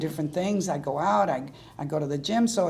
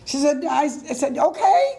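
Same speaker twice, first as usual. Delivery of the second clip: excited disbelief; 8 semitones higher, 6 dB louder than usual. Retold or imitated. Retold excited phone reaction.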